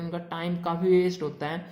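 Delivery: ordinary delivery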